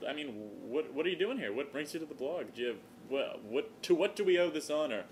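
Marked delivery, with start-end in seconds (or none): none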